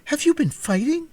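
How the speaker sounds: very high, loud shocked question; disbelieving girl scolding; girl aghast at her pet hen. Acted. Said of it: "in a shocked voice".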